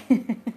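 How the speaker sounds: short amused chuckle; laugh. Real, not acted. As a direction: chuckle at the loser. Amused at the losing pet. (tawa geli pendek)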